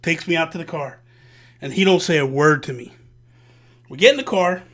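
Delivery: ordinary talk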